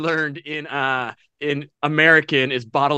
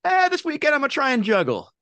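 higher-pitched, offhand mock resolve; casual imagined quote; 9 semitones higher than usual. Friend imagining a would-be juggler, casually chirped. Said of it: "Like, you're like".

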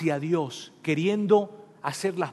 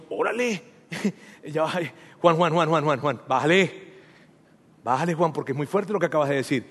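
mock protest; faster than usual. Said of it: "Y entonces tú y yo decimos".

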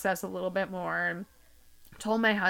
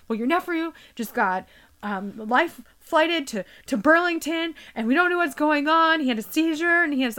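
higher, louder, rushed worry; stressed out; 6 semitones higher, 5 dB louder than usual. Retold or imitated. Stressed mom breaks news of the nephew, higher and louder. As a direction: pour out the bad news in a panic. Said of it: "she was so irritated".

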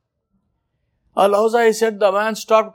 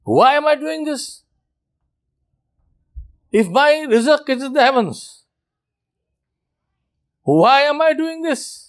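anguished robber; 5 semitones higher than usual. Retold or imitated. Robber questioning himself, voiced.

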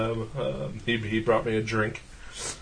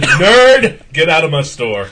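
mock angry yell; 5 semitones higher, 11 dB louder, slower than usual. Imagined clerk yelling at customer.

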